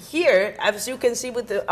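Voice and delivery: ordinary talk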